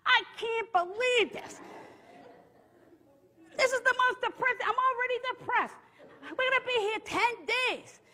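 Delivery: high, whiny mock tantrum; hammed-up wail of disbelief; bratty hollering. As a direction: complain like a bratty kid. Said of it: "that are hollering like me".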